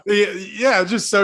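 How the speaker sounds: laughing through the words; breaking up with laughter. Real, not acted. Laughing as he wraps up the icebreaker story.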